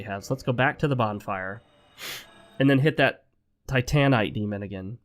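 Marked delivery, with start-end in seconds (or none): 3.7-4.3 "Titanite" said tie-TAN-ite; "Titanite" with a full, drawn TAN; proposed pronunciation. Shown as a demonstration. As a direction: stress the middle syllable of "Titanite". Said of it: "I do actually think that the proper pronunciation".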